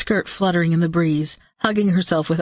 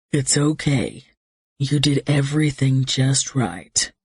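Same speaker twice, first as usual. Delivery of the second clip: reassuring; 3 semitones lower, slower than usual. Acted. Drake comforting Maggie.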